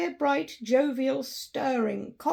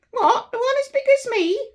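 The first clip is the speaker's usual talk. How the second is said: put-on much higher, loud boyish voice; astonished boy; 13 semitones higher, 9 dB louder than usual. The boy in Sunday clothes answering Scrooge, astonished and loud. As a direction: pipe it up like an amazed lad.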